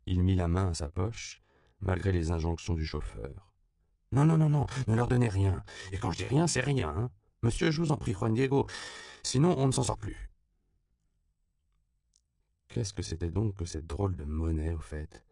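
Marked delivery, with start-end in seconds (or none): 4.1-10.3 higher and louder, a frantic plea; urgent pleading. A narrator's reading. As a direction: stop him, begging and insistent. Driver pleads against giving money.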